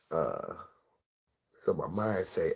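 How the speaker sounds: ordinary talk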